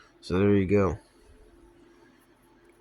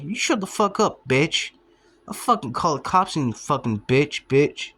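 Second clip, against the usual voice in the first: mock angry retort; 4 semitones higher than usual. Acted quarrel with the bus driver.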